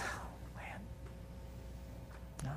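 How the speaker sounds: breathy whispered disappointment; whispered gentle rebuke. Retold or imitated. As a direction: whisper it like a head-shaking Jesus. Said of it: "shakes his head and says".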